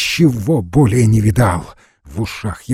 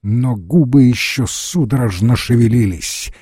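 plain reading voice

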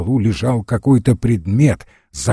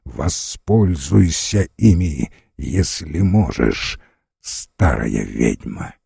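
very low, slow sneering voice; sinister stranger; 7 semitones lower, slower than usual. A narrator's reading. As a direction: sink lower, slow and contemptuous. Stranger sneering at old woman.

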